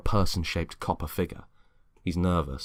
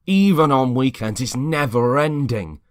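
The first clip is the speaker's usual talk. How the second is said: angry complaint; 7 semitones higher, 9 dB louder than usual. Acted. James complaining to Peter, loud and high. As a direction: stretch the grievance out.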